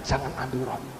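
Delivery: very soft, low retold prayer; hushed pleading, sunk low; fearful plea. Preacher recalling his fear of losing God, hushed fearful plea. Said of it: "Takut kehilangan Tuhan".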